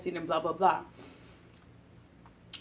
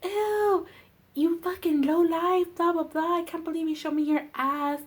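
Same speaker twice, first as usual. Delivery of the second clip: high-pitched imitation of shrieking mother, easing down; mock screaming; 9 semitones higher than usual. Daughter mimics her mother's reaction to being mooned, mock screaming. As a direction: imitate an appalled mother's shriek, without full volume. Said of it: "she starts fucking screaming".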